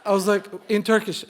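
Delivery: ordinary delivery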